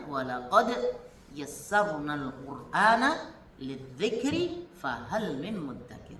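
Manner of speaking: slow, drawn-out chanted verse; Quran recitation. Acted. Teacher reciting a Quran verse to the class, slow chant. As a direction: recite the verse slowly, with devotion. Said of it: "Allah Subhanahu wa taala berfirman".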